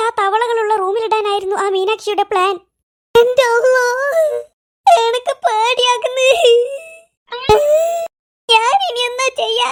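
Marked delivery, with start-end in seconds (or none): 3.1-9.7 hammed-up frightened wailing; crying in fear. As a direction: wail it out, drawing the words into sobs. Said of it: "നിങ്ങൾ എന്തിനാ കരയുന്നത്". (പേടിച്ചുള്ള കരച്ചിൽ)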